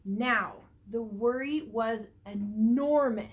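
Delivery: big dramatic telling. A narrator's reading. Teacher telling kids how big the worry grew, loud and dramatic. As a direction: make the worry sound huge. Worry grows huge in story.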